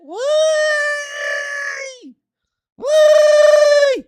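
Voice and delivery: very high, loud drawn-out howl of despair; anguished wail. Real, not acted. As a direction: wail it to the heavens. Despair over the processor bug.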